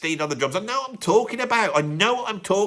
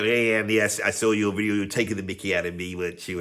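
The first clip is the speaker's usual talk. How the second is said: low, soft, chummy mumble; 10 semitones lower, 4 dB quieter, faster than usual. Acted. Chummy rock star greeting his critic, low soft impression. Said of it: "I would like to meet [name]".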